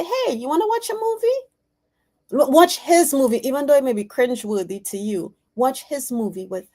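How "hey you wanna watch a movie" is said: high, sing-out casual invite; friendly invitation. Acted. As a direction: call out an easy invitation, pitched high. Models inviting son to a movie.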